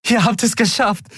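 high jubilant voice; cheering. A narrator's reading. Endriel cheering at the returning crew. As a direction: cheer it out with joy. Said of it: "jubelte Endriel".

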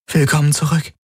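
light, high woman's voice; tender greeting. A narrator's reading. Kirai welcomes the waking patient back, in a high voice.

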